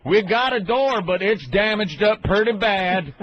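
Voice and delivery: slow hillbilly drawl, stretched out; mock hillbilly accent on the phone. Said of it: "some hillbilly going".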